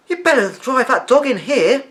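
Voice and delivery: a grandma cheerfully shooing the dog in; cheerful Maisie. A narrator's reading. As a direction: call it out cheerfully. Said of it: "said Maisie cheerfully".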